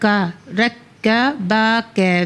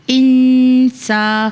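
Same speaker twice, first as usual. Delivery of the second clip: drawn-out chanted blend of the syllables; slow Quranic chant; slow recitation; 3 semitones higher, 3 dB louder, much slower than usual. Shown as a demonstration. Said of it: "الف نون زیر ان".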